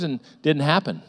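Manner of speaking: normal talking voice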